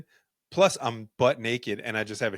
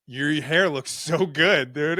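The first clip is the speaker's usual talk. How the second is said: louder, higher, slowed compliment; mock admiring compliment; 6 semitones higher, 6 dB louder, slower than usual. To his intubated friend in the ICU, loud slow teasing compliment. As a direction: gush over the hair, teasing.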